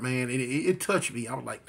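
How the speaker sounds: usual speaking voice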